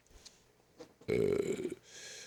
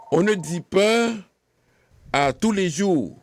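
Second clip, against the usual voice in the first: "On ne dit pas" said much higher and louder; emphatic warning; 8 semitones higher, 4 dB louder than usual. Shown as a demonstration. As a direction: warn the class sharply. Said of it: "mais bien". (mise en garde appuyée, voix haute et forte)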